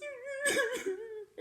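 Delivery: high wavering mock cry; a hammed-up bear wail. A narrator's reading. The bear crying for his friends, a high mock wail.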